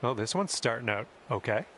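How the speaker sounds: ordinary talk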